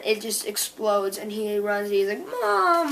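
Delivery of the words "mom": high, drawn-out mock wail of "Mom"; imitated crying out. Boy running as house explodes, mock crying out for mom. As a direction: cry out long and high, falling away.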